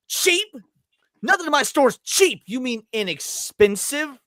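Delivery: high, indignant imitation of an insulted seller; offended shop owner. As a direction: act insulted, slowing on the correction. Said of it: "he gets so fucking offended".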